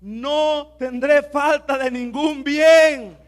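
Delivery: drawn out slowly, high and loud; very slow, loud declaration. Shown as a demonstration. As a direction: stretch every word for them to repeat.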